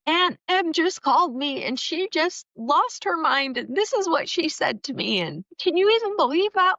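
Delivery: high, tearful voice, words breaking as if crying; reliving the sobbing call, pleading and upset; retold sobbing. Crying to her mom on the phone about the aunt, tearful and high. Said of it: "I start sobbing".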